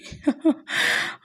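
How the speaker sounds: a quick airy giggle at her own joke. Real, not acted. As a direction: laugh lightly under the breath.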